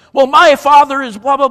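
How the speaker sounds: very loud, high mock argument; imitated shouted boast; yelling back. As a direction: shout it like a quarreling man. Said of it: "they're yelling back and forth".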